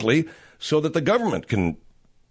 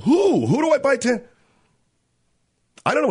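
high, bewildered voice, much higher; clueless official; 9 semitones higher than usual. Acted. Host voices a lost official asking where to buy tents, high and bewildered. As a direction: play the helpless official who has no idea.

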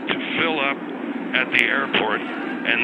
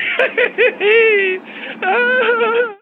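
shrieking burst of laughter; loud high laugh; 7 dB louder than usual. Real, not acted. Big laugh at pigs joke.

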